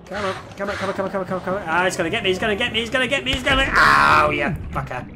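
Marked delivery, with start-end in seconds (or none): none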